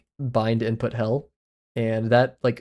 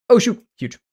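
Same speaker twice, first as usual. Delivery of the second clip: high, loud surprised burst; excited; 10 semitones higher, 9 dB louder than usual. Real, not acted. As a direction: react with thrilled surprise. Gets a big message.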